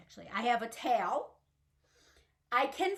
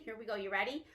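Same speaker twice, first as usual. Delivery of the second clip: ordinary talk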